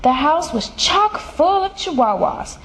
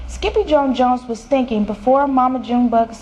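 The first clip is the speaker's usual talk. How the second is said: ordinary delivery, nothing marked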